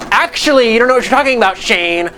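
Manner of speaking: put-on "well, actually" voice; mock know-it-all commenter. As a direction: imitate a smug online corrector. Mimics a correcting game developer.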